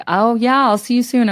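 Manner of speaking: loud, high, breezy pretend goodbye; fake phone chat. Rider faking a boyfriend call in an Uber, loud pretend chat. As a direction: chat showily to a made-up caller. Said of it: "pretending to be on the phone with a boyfriend".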